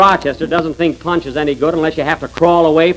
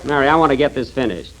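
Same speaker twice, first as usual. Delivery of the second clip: ordinary delivery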